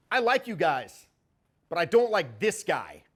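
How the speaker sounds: loud mock taunt from the girl; a half-acted loud jeer at him; retold loud jab. Girl mocks him loudly in front of clients.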